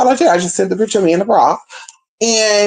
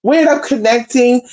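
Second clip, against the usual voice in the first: ordinary talk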